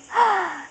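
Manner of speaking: big mock gasp of surprise; playful gasp. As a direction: gasp for the baby. Peekaboo with baby.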